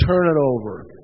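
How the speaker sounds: hint of a smug sponsor reciting a stock phrase; mocking party line. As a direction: parrot the pious program advice with scorn. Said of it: "I'd get the fucking party line".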